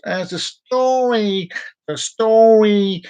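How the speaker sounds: hammed-up drawn-out storyteller voice; mock dramatic. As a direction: draw out the words like a spooky storyteller. Dramatic repetition.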